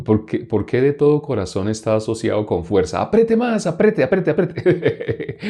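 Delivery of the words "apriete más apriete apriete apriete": high-pitched, comic straining commands; mock urging. Preacher jokes about forcing more effort into prayer, mock urging. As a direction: cheer on the squeeze in a parody voice. Parodia a quien manda apretar.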